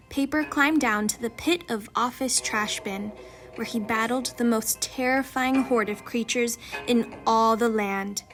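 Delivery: ordinary delivery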